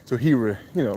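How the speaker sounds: usual speaking voice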